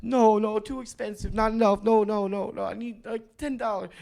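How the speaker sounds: high, insistent no, no; refusing beggar woman. Retold or imitated. The woman refuses the hot dog and demands ten dollars, high insistent voice. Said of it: "And she's like".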